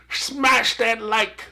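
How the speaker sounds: slow, high, lightly hammed-up slogan; mock YouTuber. Host parodies the like-button plea, lightly mocking YouTuber. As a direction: stretch out the catchphrase in mild parody.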